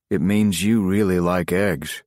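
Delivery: slow, dry, unsmiling punchline; deadpan. Acted. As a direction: hold a straight face on the joke. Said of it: "with deadpan delivery".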